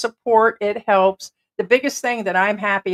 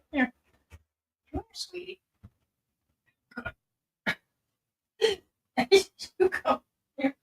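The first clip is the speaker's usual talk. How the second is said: pet talk; 12 semitones higher, 8 dB quieter than usual. Real, not acted. Owner coaxing her dog over, in high cooing baby talk. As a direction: coo at the pet sweetly.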